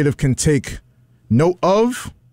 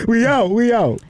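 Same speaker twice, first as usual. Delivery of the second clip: high mock voice; 9 semitones higher than usual. Joining the bit.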